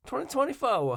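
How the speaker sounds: practicing Harris's Southern "twenty twenty-four"; Southern accent attempt. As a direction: stretch it into a Southern twang. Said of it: "She's teaching me how to do it".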